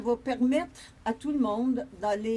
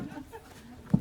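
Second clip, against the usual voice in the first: higher, amused real laugh; short laugh; 5 semitones higher than usual. Real, not acted. Speaker after joking about being too small, short laugh. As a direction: laugh at your own joke. Laughs at her own joke.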